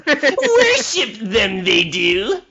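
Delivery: a high, slow Yoda-style read of the quote; high, slow Yoda imitation; Yoda phrasing. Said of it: "the little Yoda turn of phrase".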